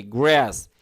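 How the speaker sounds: গ্রাস with American flat "æ", "græs"; American pronunciation. Shown as a demonstration. Teacher demonstrates for students, American pronunciation of গ্রাস. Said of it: "American উচ্চারণ কি?".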